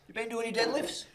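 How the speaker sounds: high, sing-song mock question; sugary high teasing coach voice. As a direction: send up the coach sweetly quizzing him. [name] mock-quizzing player.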